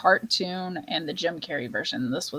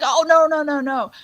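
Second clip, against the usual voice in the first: louder, higher string of protests; mock alarm; 5 semitones higher, 6 dB louder than usual. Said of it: "He's like".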